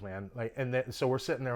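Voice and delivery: normal talking voice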